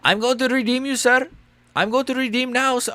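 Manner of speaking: high, put-on Indian accent voicing the fighter; mock Indian accent. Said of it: "These two Indians going at it".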